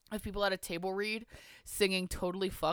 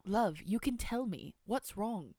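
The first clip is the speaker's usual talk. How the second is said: slow, gentle posh British voice; posh British dialect, unhurried; British accent; 3 semitones higher, 4 dB quieter, slower than usual. A narrator's reading. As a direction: coax softly in an old-fashioned British accent. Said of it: "My British dialect's so old".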